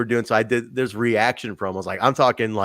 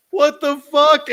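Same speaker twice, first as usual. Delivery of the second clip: high, louder mock shock; a startled yelp at the ruined take; 6 dB louder, slower than usual. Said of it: "reacting to laugh ruining promo".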